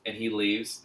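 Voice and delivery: ordinary talk, nothing marked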